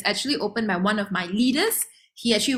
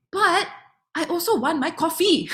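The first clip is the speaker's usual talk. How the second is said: uncle voiced; 7 semitones higher than usual. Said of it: "And he said".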